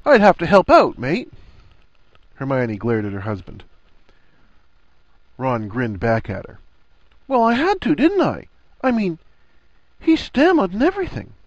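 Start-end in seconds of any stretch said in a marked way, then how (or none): 0.0-1.3 loud, high, cheeky reveal; grinning punchline. A narrator's reading. Ron springing a cheeky joke on Harry, gleeful. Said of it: "his face split into a broad grin". 7.3-11.3 loud, high-pitched mock protest; cheeky self-defence. A narrator's reading. Ron justifying his teasing to his wife, cheeky.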